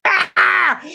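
high retold yell. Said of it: "I used to yell".

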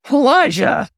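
high, sharp barked name; manager's snarl. A narrator's reading. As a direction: snap his name out like an angry boss. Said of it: "The sharp, familiar snarl of his manager".

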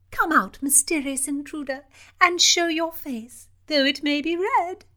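high, loud playful taunt; teasing princess. Acted. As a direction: dare him to show his face. Princess taunts the hidden intruder.

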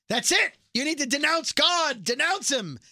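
high, loud mock outburst; acted furious demand. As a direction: order it like an outraged leader. Kincannon demanding a denouncement, retold.

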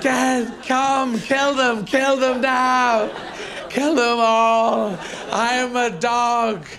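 high, drawn-out alarm cries; fanatical barking dog. Acted. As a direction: howl out the words as a zealous dog. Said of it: "They just go".

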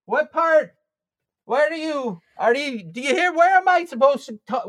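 put-on high, confused old politician fumbling; bumbling Giuliani at the mic; Rudy Giuliani voice.

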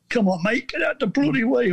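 high-pitched impatient heckle, acted out. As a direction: mimic fed-up blokes behind you in the queue. Impatient crowd quoted in story.

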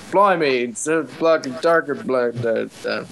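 low, mumbled mock British accent; bad British accent. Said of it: "I don't do a good British accent".

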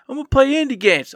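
high-pitched mock eagerness; mocking past promise. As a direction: parrot a naive promise up high. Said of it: "What else did you".

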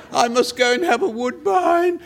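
a merry, theatrical excuse to slip out; laughing quote. Retold or imitated. Actor excusing himself for a cigarette, laughing and high. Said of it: "he used to say".

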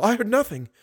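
high-pitched, hurried denial; anxious girl. Acted. Girl hides the cry from monster, anxious. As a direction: deny it in a high girl's voice.

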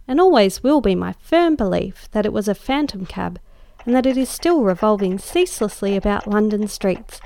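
plain reading voice

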